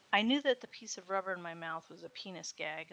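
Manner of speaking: plain reading voice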